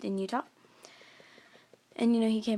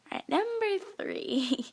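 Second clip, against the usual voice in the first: drawn-out announce; 8 semitones higher, much slower than usual. Real, not acted. Drawn-out announcement before a retake.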